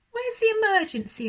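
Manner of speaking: high, loud, fast horse question. Acted. Horse voiced in story.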